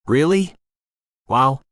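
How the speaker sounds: high, rising "Really?", then an impressed "Wow"; fascinated. A narrator's reading. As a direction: react with keen interest. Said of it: "I said, fascinated".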